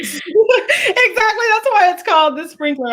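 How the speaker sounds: high voice through laughter; laughing, drawn-out agreement; real laughing. Real, not acted. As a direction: try to explain while cracking up. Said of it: "I couldn't I was laughing".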